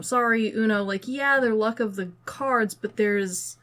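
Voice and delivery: ordinary delivery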